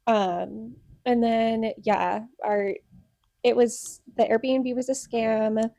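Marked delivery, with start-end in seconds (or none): none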